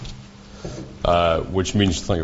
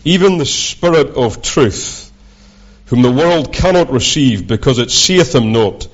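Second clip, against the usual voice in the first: scripture reading; 3 semitones higher than usual. Acted. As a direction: read the verses out like a preacher. Reads John aloud, preaching.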